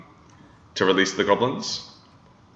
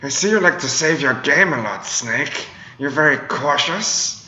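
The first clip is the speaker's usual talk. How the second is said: high, eerie taunting villain voice; Psycho Mantis voice; 7 semitones higher, slower than usual. Retold or imitated. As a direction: taunt Snake like a creepy mind reader. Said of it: "my Psycho Mantis impression".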